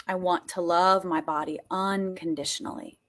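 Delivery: low, softly sincere; subdued heartfelt affirmation; gentle affirmation. Shown as a demonstration. Gentle take before the emphatic one.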